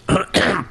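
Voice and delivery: a rough throat clear. Real, not acted. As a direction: clear your throat mid-sentence. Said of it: "my coughing and my clearing my throat".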